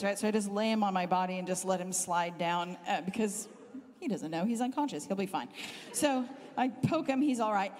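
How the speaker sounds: ordinary delivery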